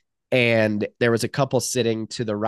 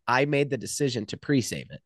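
usual speaking voice

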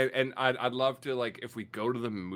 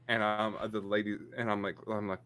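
ordinary talk

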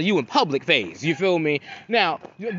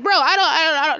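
incredulous bro; 9 semitones higher, 3 dB louder than usual. Retold or imitated. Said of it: "I'm like".

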